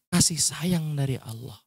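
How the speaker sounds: slow, softened stress on "kasih sayang dari Allah"; gentle breath emphasis. Shown as a demonstration. Ustadz showing gentle emphasis, "kasih sayang dari Allah" slow and soft. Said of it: "kata yang halus hanya dengan nafas".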